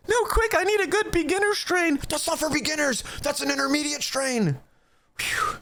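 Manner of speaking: high, squeaky put-on voice for a clueless newbie; mocking a panicky newbie; mock newcomer voice. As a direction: ham up a jittery beginner asking.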